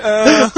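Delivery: loud, high mock groan of suffering; pained groan. Co-host mimics Fernando asked about the game, pained groan. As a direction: imitate a suffering friend's groan. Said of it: "senti a dor na voz do Fernando".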